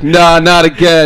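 mock manager. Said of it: "Was he like".

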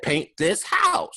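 loud, high, drawn-out quote; Brandy. Retold or imitated. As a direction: stretch it out with showy volume. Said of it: "Brandy said".

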